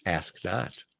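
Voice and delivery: low, soft, sly retort; smiling reply. Retold or imitated. Speechwriter answering who wrote that line, quiet smiling reply. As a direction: drop the voice for a quiet smiling punchline. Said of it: "Sorensen would smile and say".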